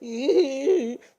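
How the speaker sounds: high, loud, sustained fake wail; mock crying wail. Put her in the cage, imitating crying wail. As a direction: imitate bawling on one long high note. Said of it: "just crying".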